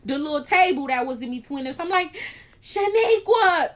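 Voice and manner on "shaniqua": high, loud, drawn-out call. Retold or imitated. Patient calls her sleeping friend, high, loud and slow. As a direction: call her name slowly to wake her. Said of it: "about to say how loud I was".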